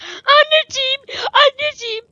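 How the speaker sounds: very high, loud whiny cry of Anneciğim; hammed-up wail for mommy; mock crying for mommy. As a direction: squeal like a scared kid calling mommy.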